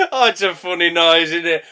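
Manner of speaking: usual speaking voice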